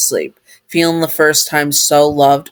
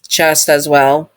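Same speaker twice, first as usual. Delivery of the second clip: ordinary delivery, nothing marked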